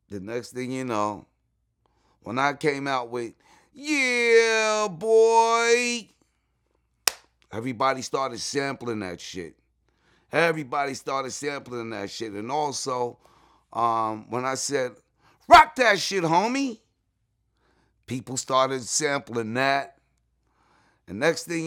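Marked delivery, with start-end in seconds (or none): 3.7-6.1 long, high, loud holler; hype-man catchphrase. Shown as a demonstration. Demonstrating his sampled signature catchphrase. 15.5-16.8 high, loud shout; hype-man catchphrase. Shown as a demonstration. Demonstrating his other sampled catchphrase.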